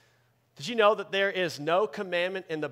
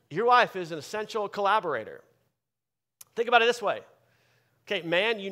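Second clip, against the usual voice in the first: louder, higher preacher's call to husbands; preaching; 4 semitones higher, 5 dB louder than usual. Acted. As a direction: drive it home to the men in the pews. Preaching to husbands at full force.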